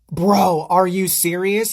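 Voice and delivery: loud, slowed-down mock indignation; indignant confrontation. Goddaughter confronting the boy at the party, indignant.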